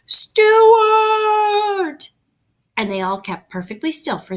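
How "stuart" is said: long, high, loud call into the hole. A narrator's reading. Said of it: "they all called".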